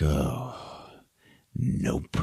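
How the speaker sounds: soft, breathless dread; grim whisper. Retold or imitated. Rauno realizing the herd is lost, soft dread. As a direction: whisper it, seeing the worst. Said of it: "he's like".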